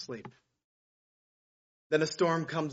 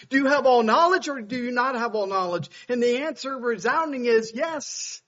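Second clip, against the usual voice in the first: higher and louder, driving sermon cadence; full-force preaching; 7 semitones higher, 8 dB louder than usual. Real, not acted. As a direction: build the declarations like a revival preacher.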